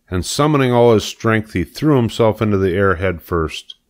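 ordinary delivery, nothing marked

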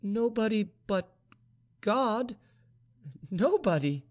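high, halting, hollow despair; hollow-voiced, hopeless young woman. A narrator's reading. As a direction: leave empty pauses between the words, pitched high. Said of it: "murmured Vaninka in a hollow voice".

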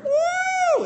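very high wailing "woo"; drawn-out falsetto siren; siren imitation. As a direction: wail like a police siren. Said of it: "that reminds me of Sock Cop Siren".

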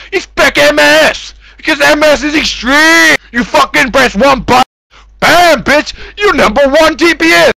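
cocky gamer bravado; hyped boast. Acted. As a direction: pump it up like a hype man.

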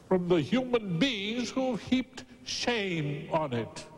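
slow, high, stretched-out sermon delivery; impassioned preaching. Acted. Preacher to congregation on God's good name, impassioned preaching. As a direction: draw out each key word like a pulpit orator.

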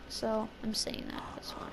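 trailing off into a whisper. Real, not acted. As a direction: drop to a whisper.

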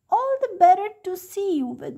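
high fake granny voice of the wolf; wolf as grandma. A narrator's reading. Wolf in grandma's bed answers the girl in a high fake granny voice. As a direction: pitch it up, sly and sweet. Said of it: "said the wolf".

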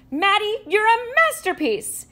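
high, loud delighted exclaim; proud dad bursting with joy. Acted. Dad admiring glue-covered son, high delighted cry.

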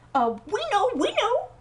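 frantic cry. Acted. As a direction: blurt it out in a panic. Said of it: "cried the two sisters".

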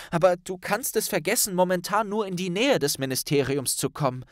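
a hint of a worried schoolgirl; young Hermine voice. A narrator's reading.

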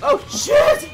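very high, loud yell; panicked shout. Real, not acted. Player swarmed in game, panicked high yell. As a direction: scream the curse in panic.

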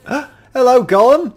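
excited greeting. Real, not acted.

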